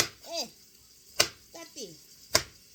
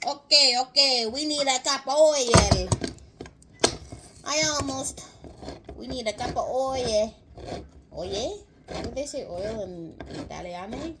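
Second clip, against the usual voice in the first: loud, hammed-up Italian accent; 6 dB louder than usual. Cook calling for oil, in a big Italian accent. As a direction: declare it like a comic Italian cook. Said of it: "Forgive my Italian accent".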